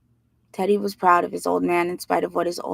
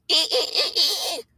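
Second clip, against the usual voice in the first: slow, much higher squeaky "ee ee ee" laugh; odd high cackle; 11 semitones higher, slower than usual. A narrator's reading. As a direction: draw out a goofy high-pitched cackle. Teddy's weird laugh voiced.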